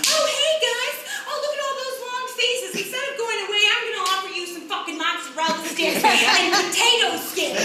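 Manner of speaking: high, loud, oblivious waiter voice; socially inept server. Acted. Waiter greeting a miserable family at the booth, socially inept. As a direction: chirp it like a clueless server. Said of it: "most socially inept person I've ever come across".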